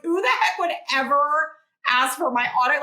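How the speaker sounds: high, loud scoffing disbelief; incredulous. Retold or imitated. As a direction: scoff as if the idea is ridiculous. Voicing her disbelief at mentor's order.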